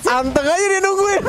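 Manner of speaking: high, amused laughing call. Real, not acted. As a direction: laugh as you point it out. Caster amused at waiting Kairi. (tertawa geli)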